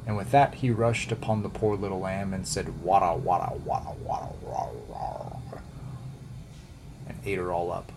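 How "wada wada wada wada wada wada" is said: wolf wolfing down his prey, fading away; chomping wolf. A narrator's reading. Wolf devours the lamb.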